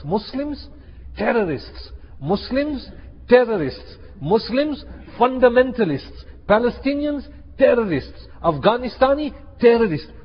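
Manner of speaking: high, emphatic list of paired labels; mock headline chant, word by paused word; mimicked media labelling.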